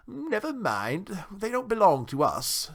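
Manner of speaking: high, drawn-out town mouse voice; grand little host, reassuring; Johnny Town Mouse, dismissive. A narrator's reading. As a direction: brush it off loftily, high and slow.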